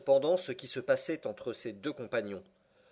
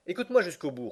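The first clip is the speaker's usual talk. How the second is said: voix poussée, plus haute; en forçant la voix; 5 semitones higher, faster than usual. Acted. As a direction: imposer l'écoute en haussant le ton. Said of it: "en forçant la voix". (le professeur force la voix pour se faire entendre)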